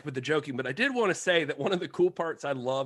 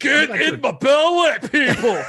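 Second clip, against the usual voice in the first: high, loud, hammed-up Scottish bellow; Scottish accent; 12 semitones higher, 8 dB louder than usual. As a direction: shout it in a comically thick Scottish accent. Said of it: "No, you went Scottish".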